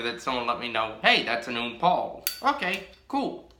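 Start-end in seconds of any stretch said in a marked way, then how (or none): none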